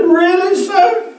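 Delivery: put-on high, wondering old homeless man; old man's amazement. Homeless man voiced in story.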